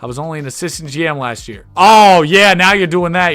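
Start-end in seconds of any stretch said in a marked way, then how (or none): 1.8-3.4 mock indignant outburst. Real, not acted. Retort to rival GM.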